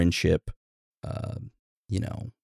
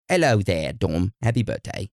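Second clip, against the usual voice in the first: lightly put-on British accent; mock British accent. Host wishing listener happy birthday in British accent. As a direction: greet him in a playful British accent. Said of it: "he wants a birthday in our best British accents".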